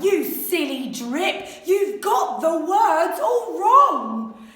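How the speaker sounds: slow, loud scolding yell; mocking yell. A narrator's reading. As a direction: yell at him for getting it wrong. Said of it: "the others yelled".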